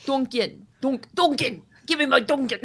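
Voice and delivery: playful mock New England accent. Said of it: "New England accent".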